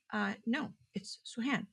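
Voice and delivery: breathy whisper of her own name; hushed correction. Retold or imitated. Correcting the vendor's name for her in a whisper. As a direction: whisper it, a little cowed.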